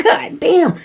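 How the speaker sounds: very high-pitched, stunned outburst; astonished. Retold or imitated. Reliving the meditation's revelation, astonished. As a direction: let amazement push the pitch right up. Retold shock at spirit guide revelation.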